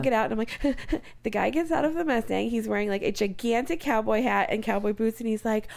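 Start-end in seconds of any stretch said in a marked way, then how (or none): none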